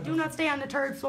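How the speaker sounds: mock Irish accent; lightly put-on Irish brogue; imitated Irish accent. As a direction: copy a friend's attempt at an Irish accent. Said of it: "give us your best Irish accent".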